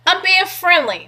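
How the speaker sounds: ordinary talk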